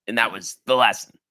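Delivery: ordinary delivery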